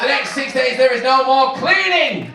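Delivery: hammed-up shouted announcement; triumphant shout. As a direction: yell it like the best news ever. Shouted climax of the comic list.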